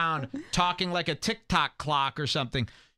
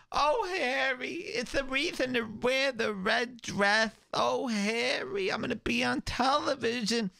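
Requiem for a Dream mom; 11 semitones higher than usual. Retold or imitated. Mocking the friend's mom, high and dreamy. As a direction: float it out like a dazed old lady. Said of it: "the mom from Requiem for a Dream".

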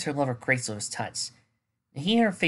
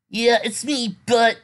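hesitant; 10 semitones higher, 7 dB louder, slower than usual. Acted. Spike voiced, hesitant.

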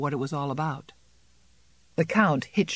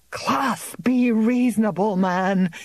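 higher, pressing plea to calm him; anxious plea; 5 semitones higher, 3 dB louder, slower than usual. A narrator's reading. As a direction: beg the farmer to stop. Frightened bailiff pleads with farmer.

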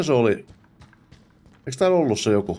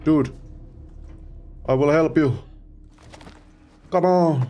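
urgent plea; 7 semitones higher than usual. Real, not acted. Pleads with game character.

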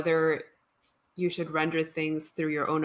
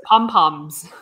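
laughing it out, high; a giggle breaking through the word; real amused laugh; 5 semitones higher, 8 dB louder than usual. Real, not acted. Laughing about round Pomeranians.

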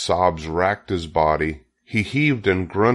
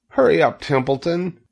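urgent plea; 9 semitones higher than usual. A narrator's reading. Wilbur urges the rat to hurry, urgent plea. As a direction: urge him on in a high voice.

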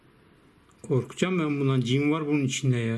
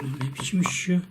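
exasperated whisper under the breath. Real, not acted. Fed up with the phone, whispered aside. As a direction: hiss it to yourself in disbelief. Muttering at broken device.

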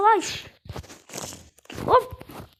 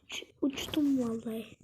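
whispering; 5 semitones lower, 6 dB quieter than usual. Real, not acted. Awed whisper.

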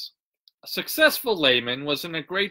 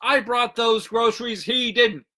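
raised, higher-pitched protest; mildly hollered objection; crying out; 6 semitones higher than usual. A narrator's reading. Neighbor jumps from bushes to claim credit, higher-pitched cry. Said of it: "and cried".

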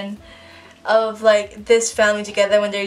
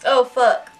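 normal talking voice

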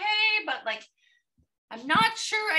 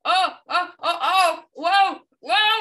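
losing balance; 7 dB louder, slower than usual. Acted. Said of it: "I'm losing my balance".

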